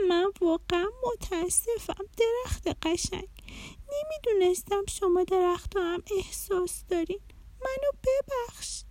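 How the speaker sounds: put-on high, soft little-girl voice full of sorrow; tearful apology. A narrator's reading. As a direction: apologize to the tree near tears. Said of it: "قلب دورنا پر از غصه شد و اشکش در اومد".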